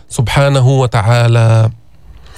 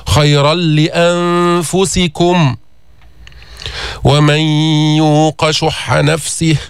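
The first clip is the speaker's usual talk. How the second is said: very slow chanted recitation with nasal merging; 2 semitones higher, much slower than usual. Shown as a demonstration. Teacher recites with nasal merging for students. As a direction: draw the words out with ghunna. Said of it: "إدغام ناقص".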